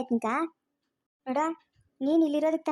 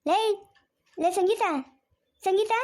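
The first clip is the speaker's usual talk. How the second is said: higher, rising repeated call; 6 semitones higher than usual. Acted. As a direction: call the name insistently. Calling out for Sangeetha. (ಮತ್ತೆ ಮತ್ತೆ ಕೂಗಿ ಕರೆಯುವ ದನಿ)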